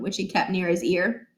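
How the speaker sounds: ordinary delivery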